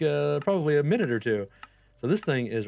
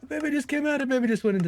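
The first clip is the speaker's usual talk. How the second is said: much higher, squealing with delight; excited. Real, not acted. Baby villager appears.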